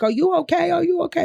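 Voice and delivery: high-pitched Brooklyn Italian concern; imitated Italian-American man. Bystander to woman fainted on the train, Brooklyn Italian accent. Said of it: "I will never forget this man's voice".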